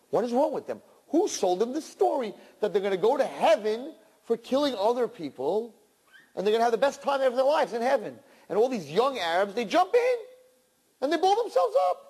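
high, impassioned sermon climax; preaching at full force. Acted. Rabbi railing against suicide bombers, impassioned and climbing.

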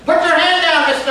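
high, loud mock scolding; hammed-up stern technician. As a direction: bark it like a cross technician.

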